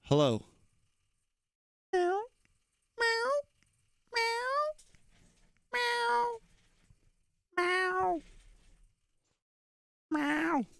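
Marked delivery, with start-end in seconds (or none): 1.9-10.6 high, soft mewing meows; cat meows. Pretend. Streamer meows at the kitty, high and soft. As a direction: mew like a little kitten.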